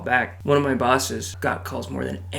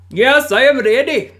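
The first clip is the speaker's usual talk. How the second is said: high, loud eager reply; hammed-up scammer saying yes; mock eager scammer; 10 semitones higher, 7 dB louder than usual. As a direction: answer like a scammer keen to get the number. Voicing the scammer.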